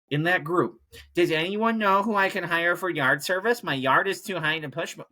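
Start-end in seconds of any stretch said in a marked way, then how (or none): 1.1-5.1 higher, whiny mock of a helpless neighbour. Neighbour posting in town Facebook group, whiny mock voice. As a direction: whine it like a lazy neighbour asking for help. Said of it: "all these fucking people".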